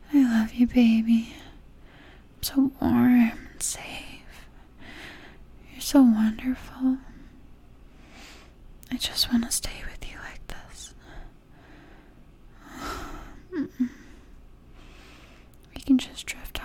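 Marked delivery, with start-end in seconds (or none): none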